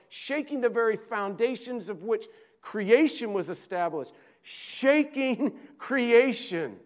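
high, loud, ringing preacher's cry; full-force preaching. Acted. Preacher listing where God must surely be, preaching at full force. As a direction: build it like a revival climax, pushing hard.

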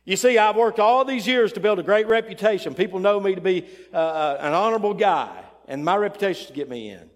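loud, high-pitched case for himself, easing down; acted proud protest; self-assured boasting. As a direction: plead your credentials loudly at the gate. First man boasts at heaven's gate.

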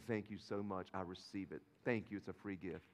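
hushed gratitude for a gift; grateful. Pretend. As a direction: receive it quietly, with real thanks. Acting out accepting a gift.

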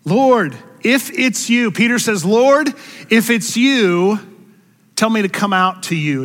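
high, loud calling-out voice for Peter. Acted. Peter calling to Jesus across the water, loud and high.

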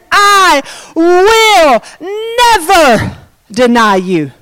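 high, drawn-out insistence, falling at the end; Peter's bold vow. Acted. Peter promising Jesus he will stay true, emphatic. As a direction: declare it slowly, word by word. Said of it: "Peter says".

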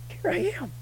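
high, soft, falsetto churchgoer; mock churchy answer. Churchgoer answering in a high, soft falsetto. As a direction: chirp it like a polite churchgoer. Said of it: "You're like".

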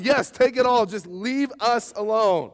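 hammed-up pleading, slowing at the end; mock desperate Egyptians. Egyptians pushing Israelites out.

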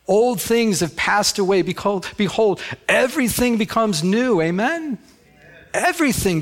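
preaching climax. Real, not acted. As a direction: lift the church with it.

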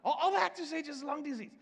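all that just to say lung disease, playful outrage; exasperated emphasis. Shown as a demonstration. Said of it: "to just simply say lung disease".

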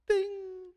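high-pitched phone ping; high ding sound. Retold or imitated. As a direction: chirp out a bright ding.

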